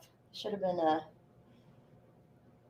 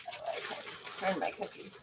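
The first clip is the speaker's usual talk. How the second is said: ordinary talk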